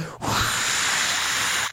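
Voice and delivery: sustained rush of breath; hard blowing. Retold or imitated. Driver re-enacting the breathalyzer blow, long exhale. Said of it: "like I was blowing up my last balloon".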